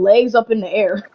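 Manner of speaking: normal talking voice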